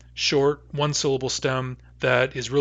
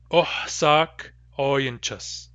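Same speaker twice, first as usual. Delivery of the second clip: slow demo pronunciation; much slower than usual. Shown as a demonstration. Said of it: "the way I would probably say it".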